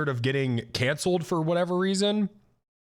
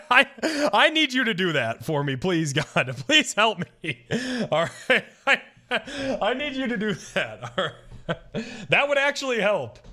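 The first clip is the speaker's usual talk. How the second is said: laughing plea; 4 semitones higher than usual. Real, not acted. Laughing while begging friend.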